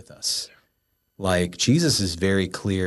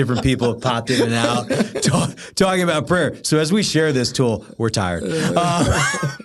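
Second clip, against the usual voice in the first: high, loud laughing talk; 6 semitones higher, 6 dB louder than usual. Real, not acted. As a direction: giggle through the words. Laughing at being tired.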